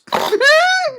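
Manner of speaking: sudden high snort of laughter. A narrator's reading. Rarity failing to hold in a snort, high snorting laugh. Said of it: "unladylike and immature snort".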